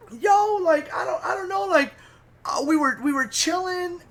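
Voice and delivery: high-pitched stammered excuse; mock flustered, halting high voice; imitating Quincy. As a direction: fumble for an answer in a put-on high voice. Acts out Quincy's muddled excuse.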